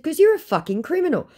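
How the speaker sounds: quick, high snap; fast sharp retort. Retold or imitated. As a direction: spit it out fast. Snapping at scammer.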